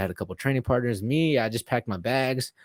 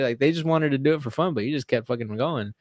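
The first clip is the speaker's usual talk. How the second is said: usual speaking voice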